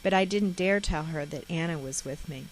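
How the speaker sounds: plain reading voice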